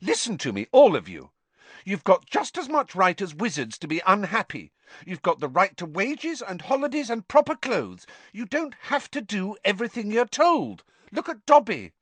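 put-on indignant girl. A narrator's reading. Hermione lecturing the elves.

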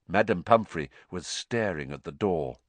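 ordinary delivery

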